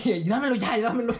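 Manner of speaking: snatch it away impatiently; mock fed-up mother; exasperated parent. Parent taking egg away.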